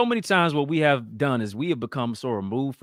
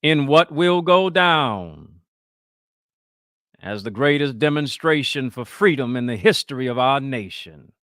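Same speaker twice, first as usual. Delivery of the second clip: higher, loud orator impression, very slow opening; Martin Luther King oratory; 5 semitones higher, 5 dB louder than usual. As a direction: stretch the first words in a melodic preacher's vibrato. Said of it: "Y'all know how you sound".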